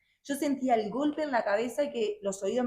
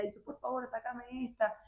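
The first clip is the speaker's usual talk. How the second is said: softer pleading plea; quiet prayer; 3 semitones higher, 7 dB quieter than usual. Retold or imitated. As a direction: murmur a desperate prayer. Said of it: "Yo arrodillada y orando". (súplica en voz baja)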